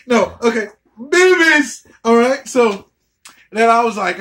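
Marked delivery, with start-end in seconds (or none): 1.0-1.9 squealed boobies. Real, not acted. Excited shout.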